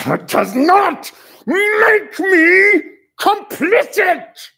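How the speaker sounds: crying, slow, high shout; anguished shout. Acted. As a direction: cry it out in outrage. Actor shouting in confrontation.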